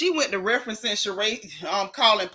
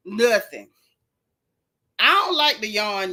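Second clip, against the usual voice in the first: ordinary delivery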